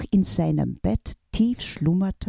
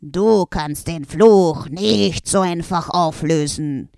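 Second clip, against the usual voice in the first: loud, gloating villain voice; evil brother wizard announcing the curse's terms; menacing evil wizard; 7 dB louder than usual. A narrator's reading. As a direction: threaten like a dark sorcerer.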